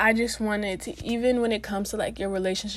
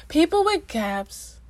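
gushing; 6 semitones higher, slower than usual. Real, not acted. Repeats it, gushing.

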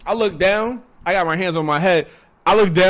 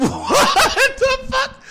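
high, drawn-out cry through laughter; laughing disbelief; 6 dB louder, much slower than usual. Real, not acted. Storyteller cracking up at his own shock, laughing. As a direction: stretch it out high as laughter takes over.

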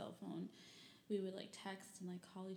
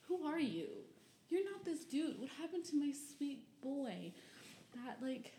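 dismayed disbelief; 7 semitones higher, 4 dB louder than usual. Retold or imitated. Dismayed at changed boy.

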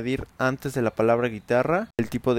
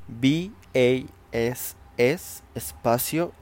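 spelling out. Shown as a demonstration. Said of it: "decimos bass guitar".

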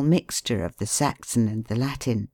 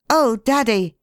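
put-on high, louder eager boy's cry; a boy overjoyed to see his father; boy greeting his father, high and eager; joyful cry; 8 semitones higher, 5 dB louder than usual. A narrator's reading. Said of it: "he cried".

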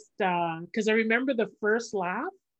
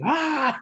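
high, drawn-out "ahh" of release; overwhelmed "ah"; 8 semitones higher, 3 dB louder than usual. Retold or imitated. Comic recalling right after the class show, overwhelmed cry. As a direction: let out a high, emotional ahh.

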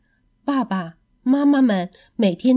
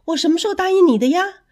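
startled; 8 semitones higher, 4 dB louder, much faster than usual. Acted. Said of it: "市长吓了一跳".

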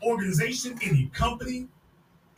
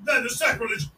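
ordinary talk, nothing marked

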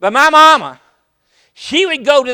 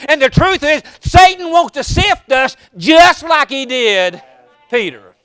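preaching; 3 semitones higher, 5 dB louder than usual. Acted. Preacher rousing the congregation, building louder. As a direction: build the sermon toward a shout.

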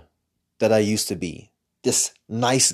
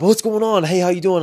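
bright, much higher and louder phone greeting; hyped up greeting; 9 semitones higher, 4 dB louder than usual. Retold or imitated. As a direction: pump up the energy as if greeting on a call. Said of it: "hyped up".

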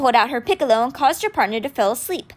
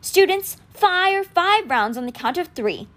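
commanding; 7 semitones higher, slower than usual. A narrator's reading. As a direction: lay out each word for the whole line. Statue commands the class.

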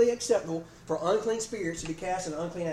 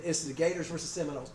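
ordinary talk

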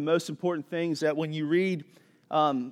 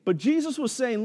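ordinary delivery

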